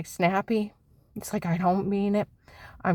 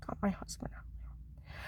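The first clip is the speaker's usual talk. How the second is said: much softer breathy whisper; 14 dB quieter than usual. Real, not acted. Wife confiding she hid her husband, whispering. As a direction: confide it under your breath. Secret about hiding husband.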